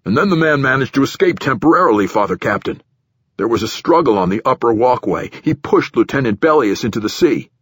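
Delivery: official giving his account to a superior, a bit pushed; nervous platform director. Acted. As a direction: voice an uneasy director justifying himself.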